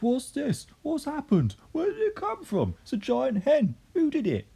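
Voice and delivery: very high, frantic questions; excited cry. A narrator's reading. Mr Cranky worked up over the hen, high frantic cry. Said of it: "he cried, waving his arms".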